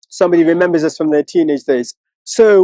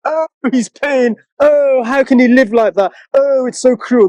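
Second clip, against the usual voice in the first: hammed-up sympathy for the poor man; mock pity; 6 semitones higher than usual. Mocking pitying empaths.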